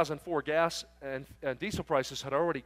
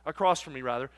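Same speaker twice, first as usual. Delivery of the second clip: ordinary delivery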